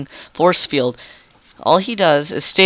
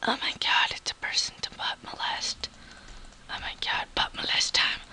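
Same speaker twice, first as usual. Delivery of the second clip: whispering; 13 dB quieter than usual. Real, not acted. Excited whispering at game.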